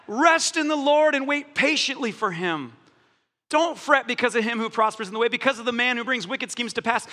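usual speaking voice

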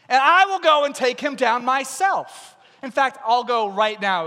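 acted fighting boast; bold declaration. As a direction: announce it with big bravado. David defiantly volunteers to fight.

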